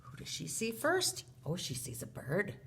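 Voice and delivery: ordinary delivery, nothing marked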